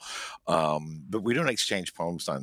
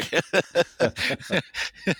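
high bright laugh; amused laugh; burst of laughter; 14 semitones higher, 5 dB louder than usual. Real, not acted. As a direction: laugh it off at the skeptics. Laughing at the skeptics.

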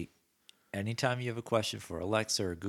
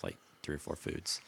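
ordinary talk

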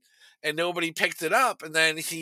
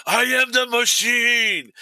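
mock-heroic shout, higher and drawn out; booming boast; 6 semitones higher, 4 dB louder, slower than usual. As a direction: bellow it slowly like a big proclamation. Repeats boast to the mafia.

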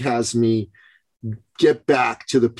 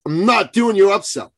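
higher and louder, leaning on "not"; mock stubborn refusal; 9 semitones higher, 5 dB louder than usual. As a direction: play the stubborn skeptic who won't be sold. Retold defiant attendee refusing pitch.

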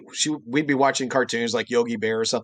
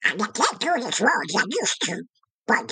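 squawky Donald Duck voice; 9 semitones higher than usual. Shown as a demonstration. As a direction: quack the words out like Donald. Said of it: "read us stories in Donald Duck voice like".

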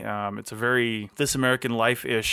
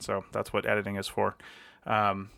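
ordinary delivery

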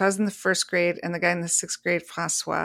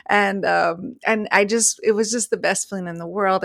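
ordinary talk

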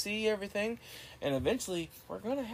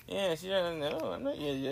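usual speaking voice

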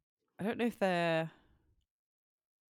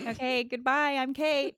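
high, loud mock American sign-off; hammed-up imitation of an American accent; bad American accent; 9 semitones higher, 6 dB louder than usual. British co-host imitating American host signing off, bad American accent. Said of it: "That was terrible".

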